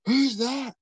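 a high, loud stage whisper that carries across the room. Retold or imitated. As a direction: hiss it out high and loud so everyone hears. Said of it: "I like stage whisper".

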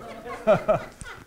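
short amused laugh; real chuckle. Real, not acted. Preacher joking about his unwashed finger, real chuckle. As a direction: chuckle at your own joke.

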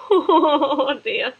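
laughing oh dear, pitched high. Real, not acted. Laughing at a toy wrapped in bandages, giggling. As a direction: giggle through the words.

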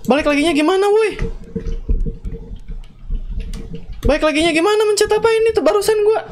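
high frantic pleading questions; panicked. Real, not acted. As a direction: rush the words in real panic. Panicking at game controls. (panik dan ketakutan, suara tinggi)

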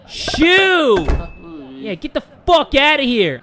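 shouting shoo. Real, not acted. Gamer yelling at the game's preacher to get out. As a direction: chase him off the porch.

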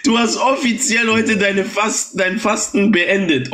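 giggling through the words, pitch jumping up; laughing. Real, not acted. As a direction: crack up while gloating over him. Gloating over the broken fast. (lachend und hämisch kichernd)

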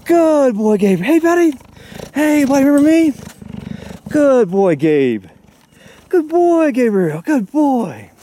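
high doting praise for a big cat; pet talk. Real, not acted. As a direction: coo at him like a beloved pet. Greeting the cheetah through fence.

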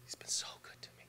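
barely voiced, tears in the throat; a whisper through a breaking voice; choked whisper. Retelling grieving friend's words, choked whisper. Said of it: "That's all he could say".

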